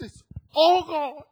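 slow, high, loud wail of loss; anguished cry. Retold or imitated. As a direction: drag it out in despair. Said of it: "be just crying".

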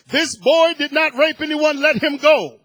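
loud, high commanding proclamation; preacher voicing heaven to the lynch mob, loud command. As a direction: announce it like heaven speaking over the mob.

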